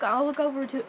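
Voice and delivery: normal talking voice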